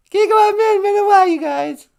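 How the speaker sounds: squeaky high puppet voice, loud; high falsetto wife voice. Acted. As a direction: falsetto Michelle puppet greeting. Michelle puppet says goodbye.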